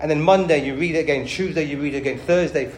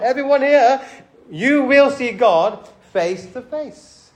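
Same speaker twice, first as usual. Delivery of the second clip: higher and slow, leaning on will; emphatic proclamation; 6 semitones higher, slower than usual. Real, not acted. Preacher telling the church they will see God, emphatic. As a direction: proclaim it to every listener.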